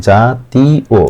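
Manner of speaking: জাতীয় slowly split as ja-ti-o; syllables, final o. Shown as a demonstration. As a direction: draw out জাতীয় syllable by syllable, ending on o. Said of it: "এই অন্ত্যস্ত ওকারের মতো". (জাতীয় ভেঙে ধীরে "জা-তী-ও")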